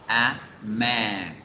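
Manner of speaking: "A man" dicho pausado, enlazando la A con man; demostración fluida. Shown as a demonstration. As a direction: say "A man" as a clear model. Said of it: "La palabra hombre empieza con la consonante M". ("A man" pronunciado de forma fluida)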